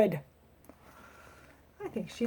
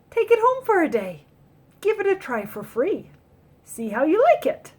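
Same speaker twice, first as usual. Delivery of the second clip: sales lady voice; 7 semitones higher than usual. Acted. Store lady offering the chair.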